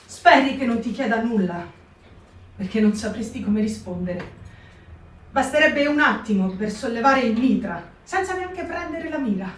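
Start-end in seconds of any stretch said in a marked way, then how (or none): none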